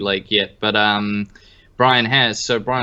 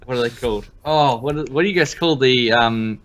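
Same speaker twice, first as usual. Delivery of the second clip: ordinary delivery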